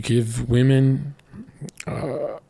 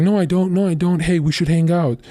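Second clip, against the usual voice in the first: freaked-out denial; 7 semitones higher than usual. Retold or imitated. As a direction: mimic her panicky insistence. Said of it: "And she freaked out".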